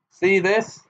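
furious father bellowing; bellowed. A narrator's reading. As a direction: bellow it at the official. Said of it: "he bellowed at Ogden".

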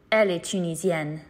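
ordinary talk

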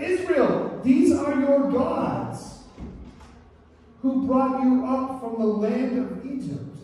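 high, drawn-out, anguished proclamation; heartbroken reading. Acted. Preacher rereads the Israelites' words to the congregation, heartbroken. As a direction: read it slowly and high, weighing each heartbreaking word. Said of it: "These words are heartbreaking".

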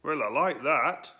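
put-on slow, high, indignant boy; huffy young lad; Edwo voice. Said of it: "said Edwo".

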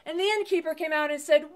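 plain reading voice

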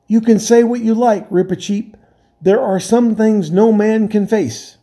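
high, tense voice of a scared captain; a sailor who can't face the dark; frightened sea captain. Acted. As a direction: Drinian pleading that no man can face this. Drinian answers Reepicheep in fear.

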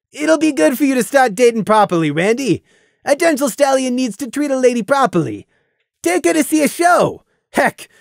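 hearty teasing buddy; teasing friend. Acted. Fiera jovial advice.

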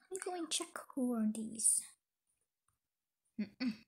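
normal talking voice

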